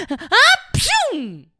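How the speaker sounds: high loud fake sneeze; acted sneeze. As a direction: burst out a big sneeze. (夸张的喷嚏声)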